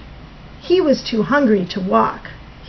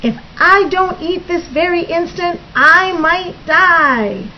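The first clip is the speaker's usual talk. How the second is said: melodramatic wail; 6 semitones higher than usual. Acted. Starving Bird calls to his friends, wailing melodramatically. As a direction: wail it, stretching out the final words.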